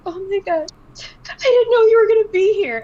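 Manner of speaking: high, loud tearful fan squeal; choked-up starstruck fan; teary fan gush. Retold or imitated. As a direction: gush like a fan fighting tears. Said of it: "like, crying".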